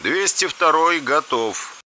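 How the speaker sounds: over radio. A narrator's reading. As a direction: report to the tower over the headset. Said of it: "быстро доложил я в эфир". (доклад в эфир, тише)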